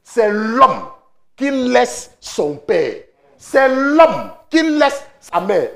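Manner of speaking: drawn-out, word by word; slow emphasis. Acted. Pastor slowly stressing who leaves father and mother. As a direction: spell it out slowly for the church.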